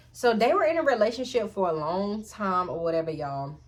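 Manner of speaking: usual speaking voice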